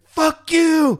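high loud shouted curse; mock angry yell. Sprayed man curses the cops, high loud yell.

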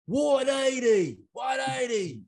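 high, slow, hammed-up Australian quarterback call; drawn out like an Aussie calling a play; Australian accent. Russell Wilson in the huddle, hammed-up Australian snap count. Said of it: "that's, like, Australian".

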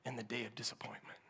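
breathy whispered phrase. Acted. Pastor whispering to the congregation about disappointment, whisper.

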